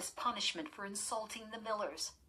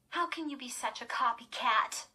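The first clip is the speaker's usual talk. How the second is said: disgusted; 6 semitones higher, 4 dB louder than usual. A narrator's reading. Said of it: "Lindy asked disgustedly".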